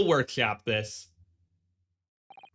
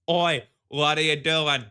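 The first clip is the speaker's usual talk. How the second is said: put-on loud London Oi in a British accent; Barry sixty-three; 5 dB louder than usual. Streamer switching to a loud cockney British voice. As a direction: bark it like a cheeky Brit. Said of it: "I can just go full Barry sixty-three".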